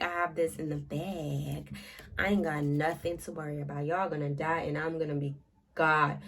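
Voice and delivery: smirk through it, sure you've already won; smug smirk. Retold or imitated. Light smugly gloating to himself about certain victory. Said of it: "He was literally smirking the whole time".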